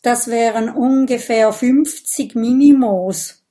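plain reading voice

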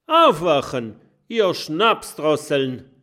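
loud, high wake-up call; shouting. A narrator's reading. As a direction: bellow at the sleeping pirates.

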